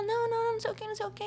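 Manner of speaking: high-pitched imitation waving off an excuse; mock dismissive protest. Producer rejecting the model's headache excuse, mock dismissive high voice.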